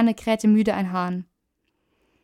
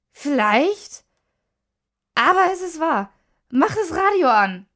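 high, raised, urgent voice; agitated insistence; 7 semitones higher, 5 dB louder than usual. A narrator's reading. Woman helped up from a faint, insisting urgently. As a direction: insist shakenly that they believe you.

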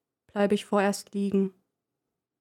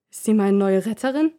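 usual speaking voice

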